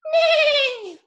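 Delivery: high, loud, long neigh; horse neigh. Acted. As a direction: neigh like a horse for little children. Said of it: "and horses go".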